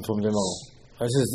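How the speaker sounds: ordinary delivery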